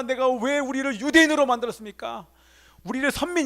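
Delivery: impassioned, high-pitched outcry; acted anguished plea, pitched high; crying out to God. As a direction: cry out in protest to God. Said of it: "이렇게 하나님 앞에 부르짖고 외칩니다".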